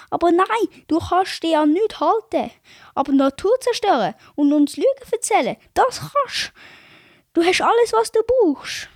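mock-outraged retelling; retold angry protest. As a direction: push back hard at the boss. [name] retells his angry reply.

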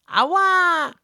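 very high, loud yelp; a tiny creature hit the wall; Fräulein Zwang in pain. Acted. Fräulein Zwang gegen die Wand geschleudert. (Fräulein Zwang jault laut und sehr hoch)

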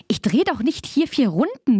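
high-pitched, baffled protest; perplexed objection, pitched well up. A narrator's reading. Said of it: "sagte Maman perplex".

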